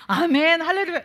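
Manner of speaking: loud, high, joyful amen and hallelujah; eager amen. Pretend. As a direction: burst out with a fervent amen. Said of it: "이래야 되는데요".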